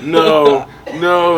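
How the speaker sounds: a loud scared no, no; panicked no. Retold or imitated. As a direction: push the fear away out loud. Terrified by shadow eyes.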